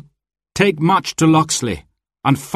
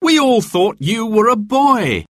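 high-pitched, amazed exclamation; astonished cry; 12 semitones higher than usual. A narrator's reading. Robin greets the unmasked Marion, high and astonished. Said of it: "cried Robin".

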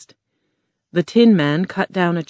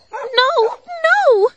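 put-on high, loud girlish cry of no; Dorothy shouting; 5 dB louder than usual. Dorothy stopping the lion from biting Toto, shouting. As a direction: shriek it to stop the lion. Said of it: "Dorothy shouted".

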